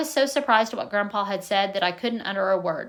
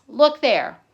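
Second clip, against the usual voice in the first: raised voice, calling attention; loud, pointing at the paper; loud call to look; 3 semitones higher, 6 dB louder than usual. A narrator's reading. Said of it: "he said in a loud voice".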